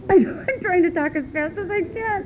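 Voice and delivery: breaking into high laughter mid-sentence; real giggling apology to the room; laughing. Real, not acted. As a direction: laugh helplessly while rushing to finish. Laughing at losing track of time.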